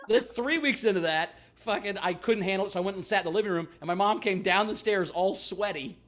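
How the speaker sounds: ordinary talk, nothing marked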